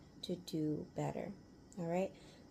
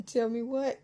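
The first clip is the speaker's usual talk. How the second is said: laughing disbelief; 5 semitones higher, 8 dB louder than usual. Retold or imitated.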